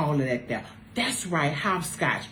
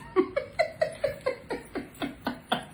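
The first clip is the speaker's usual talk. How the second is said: high wheezing laugh; 8 dB quieter than usual. Real, not acted. Burst of laughter.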